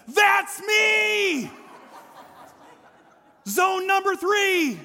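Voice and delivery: put-on high, loud, thrilled child shouting; excited little boy. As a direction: shout it like a five-year-old who can't wait. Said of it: "jumps out ... and says".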